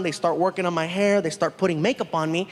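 ordinary talk, nothing marked

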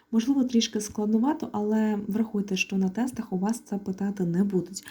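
normal talking voice

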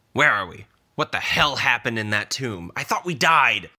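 loud, high-pitched startled outburst; panicked alarm. A narrator's reading. Newly summoned owl Pip after the tomb, panicking. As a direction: build to an alarmed cry.

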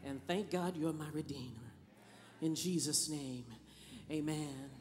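soft, low opening prayer. Acted. Preacher opening prayer, soft and low. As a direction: pray quietly before the sermon.

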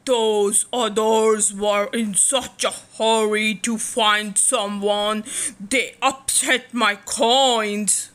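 grumpy lobster. Acted. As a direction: complain like a crabby old lobster.

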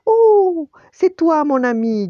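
very high, loud delighted greeting; mock surprise. A narrator's reading. Tony feigns just noticing his dog friend, exclaiming. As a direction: swoop down from a big high "oh".

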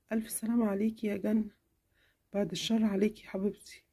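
mother consoling her daughter, softly; tender mother. Acted. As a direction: comfort her quietly.

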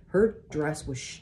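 ordinary delivery

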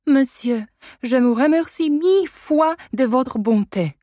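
put-on high, loud, sing-song child's thanks; curtsy the words to him like a little actress; French little girl. A narrator's reading. Adèle kneeling to thank her guardian, high and sweet. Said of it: "wołając".

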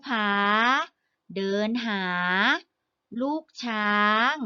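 careful, measured school-reader delivery; primer read-aloud. Acted. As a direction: read each phrase separately, with long pauses between. Reads a primer story aloud.

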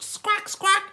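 very high-pitched bird squawks; parrot squawk. Acted. Parrot noise after request.

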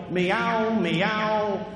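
mock tough-guy cat meows; cat talking. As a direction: meow like a tough street cat. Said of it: "If cats could talk, they go".

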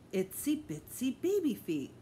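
put-on slow, sing-song baby talk; gentle baby-talk coo. A narrator's reading. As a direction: coo it to a toddler. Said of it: "Mister Fargo cooed".

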